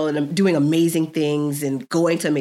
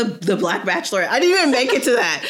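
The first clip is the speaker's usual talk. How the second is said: usual speaking voice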